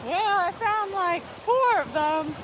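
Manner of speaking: high gleeful whoop; excited whoop. Real, not acted. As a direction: whoop it up, then gush. Excited about mushrooms.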